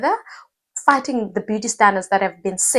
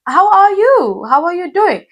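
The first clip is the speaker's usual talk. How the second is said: high, louder, emphatic how-are-you; concerned check-in; 10 semitones higher, 6 dB louder than usual. Acted. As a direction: check in on someone, pitched well up. Voicing a caring check-in question.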